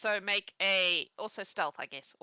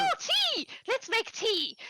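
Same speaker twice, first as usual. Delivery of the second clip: put-on overexcited old woman. Lonely farm wife greets the visitors, high and gushing. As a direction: squeal with delight at visitors. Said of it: "And Gertrude is like".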